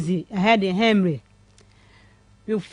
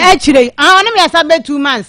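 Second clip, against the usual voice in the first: louder and higher, stressing the time; emphatic telling; 4 semitones higher, 6 dB louder than usual. Real, not acted. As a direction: lean hard on the months. Caller stresses the duration.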